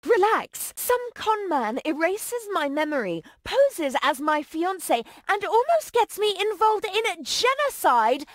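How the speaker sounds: heated, emphatic rant; indignant anger. Acted. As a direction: throw the accusation back, outraged. Furious woman confronts the con man.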